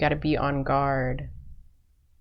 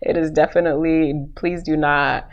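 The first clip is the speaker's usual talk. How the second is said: loud, laughing protest; laughing denial; 7 dB louder than usual. Real, not acted. Tarot reader laughing off her Jamaican slip. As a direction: laugh it off, louder. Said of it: "I am not Jamaican".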